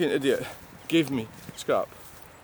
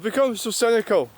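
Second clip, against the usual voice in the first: raised, higher-pitched outburst; shouting; 7 semitones higher than usual. Real, not acted. Man venting about becoming cynical, shouting. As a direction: voice jumps up high, getting carried away. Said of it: "Sorry, I'm shouting there".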